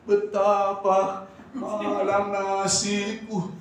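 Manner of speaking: slow, hammed-up self-pitying sigh; mock lament. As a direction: drag it out like a mock complaint. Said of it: "ngomongnya aja udah berat". (keluhan pura-pura, lambat)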